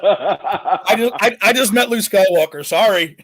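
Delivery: giggling through the words; laughing. Real, not acted. Guest apologising to the kids, laughing. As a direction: laugh while you say it.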